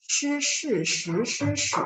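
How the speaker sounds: slow SHI SHI SHI SHI SHI, each on its own tone; tone demonstration. Shown as a demonstration. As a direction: recite each SHI deliberately with a distinct pitch shape. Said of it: "So I'll read for you".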